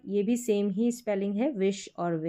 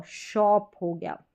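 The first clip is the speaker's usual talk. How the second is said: ordinary talk, nothing marked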